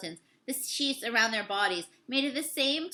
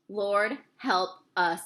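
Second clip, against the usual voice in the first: lower, very slow grave sailor voice; gruff Owen voice; 5 semitones lower, much slower than usual. A narrator's reading. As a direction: mutter it grimly as weathered sailor. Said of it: "thought Owen".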